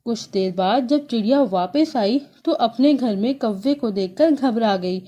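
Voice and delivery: ordinary delivery, nothing marked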